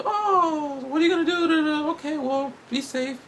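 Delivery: high, sing-song mother voice; mom's voice. Retold or imitated. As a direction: chirp it like a fussing mom. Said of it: "she's like".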